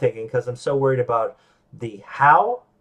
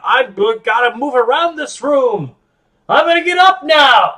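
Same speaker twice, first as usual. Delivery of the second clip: much higher and louder, slow, building to a cry; manic restlessness; 11 semitones higher, 9 dB louder, slower than usual. Retold or imitated. Telling listeners how calls make him manic, much higher and louder. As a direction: wound up, about to leap from the chair. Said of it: "like, a".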